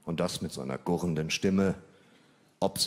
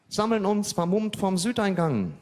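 higher, hammed-up gang call; mock hooligan post; 11 semitones higher, 3 dB louder than usual. Imagined hooligan woman posting online, mock rallying call. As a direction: read the post like a mock battle order.

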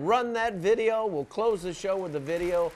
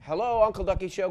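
calling hello; 2 semitones higher, 6 dB louder than usual. Acted. Answering a ringing phone.

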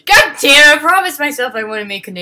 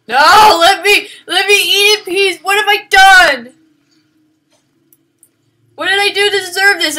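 high, loud desperate pleading; desperate wailing; 11 semitones higher, 13 dB louder than usual. Real, not acted. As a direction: wail it as if cornered. Monster attacks while eating.

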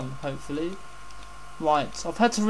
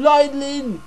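excited shout; 7 semitones higher, 7 dB louder than usual. Real, not acted. Calling out the lightning.

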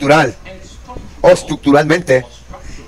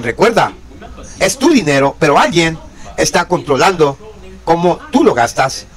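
ordinary talk

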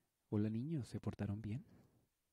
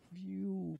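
relieved whistle; 8 semitones higher than usual. Acted.

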